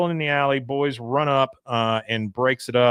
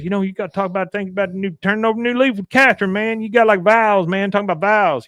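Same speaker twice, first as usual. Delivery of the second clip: higher, very fast mumble; Boomhauer impression; 8 semitones higher, 4 dB louder, much faster than usual. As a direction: rush the words like Boomhauer. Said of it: "Boomhauer says".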